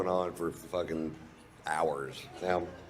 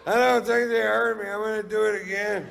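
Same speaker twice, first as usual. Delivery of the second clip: much higher and louder, half-laughing; gleeful drunk boast; 7 dB louder than usual. Retold or imitated. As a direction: crow like a proud drunk. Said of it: "I went".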